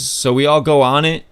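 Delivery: ordinary delivery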